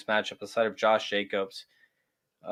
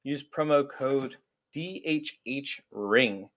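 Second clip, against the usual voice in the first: ad read; 4 dB quieter, much slower than usual. Acted. Host reading the sponsor plug to listeners, slow ad voice.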